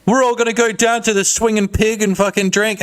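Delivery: high, eager bro; pushy bro voice. Retold or imitated.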